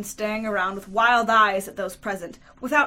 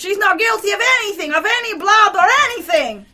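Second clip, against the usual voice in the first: loud, raised urgent shout; 11 semitones higher, 12 dB louder than usual. A narrator's reading. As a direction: shout in desperate defence of her. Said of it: "he shouted".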